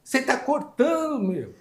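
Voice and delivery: desabafo choroso, cada vez mais agudo; chorosa e indignada. Retold or imitated. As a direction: chorando de raiva, a voz subindo muito. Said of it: "Ela já tava chorando". (funcionária chorando protesta contra o corte, cada vez mais aguda)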